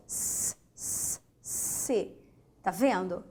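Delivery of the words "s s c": s, s as a soft voiceless hiss; teacher showing the S sound of C. Shown as a demonstration. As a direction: let the S hiss out alone. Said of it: "ó, ouça".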